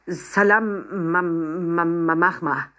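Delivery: stumbling, repeated ma ma ma; forgetting the greeting in panic; nervous stutter. Acted. Nervous Papa botches the Arabic greeting, stammering. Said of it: "stotterte Papa".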